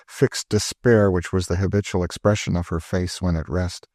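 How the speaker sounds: ordinary delivery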